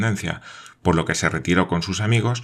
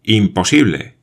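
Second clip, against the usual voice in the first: louder, disbelieving Imposible; a loud cry of disbelief; exclaimed Imposible; 3 semitones higher, 7 dB louder than usual. A narrator's reading. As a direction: refuse to believe it. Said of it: "exclamó Paganel".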